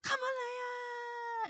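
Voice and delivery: very high, drawn-out shout; hammed-up shrieked summons, last vowel held long; character calling out. Kira calling on the animals, high mock shriek. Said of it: "And then she goes".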